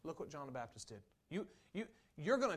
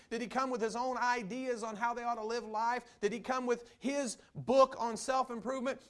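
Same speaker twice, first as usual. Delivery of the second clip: rising to a higher, louder, shouting preach; preaching at full force; 7 semitones higher, 9 dB louder than usual. Real, not acted. Preacher drives home his point to the church, building to a shout. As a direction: build the sermon to a roaring climax.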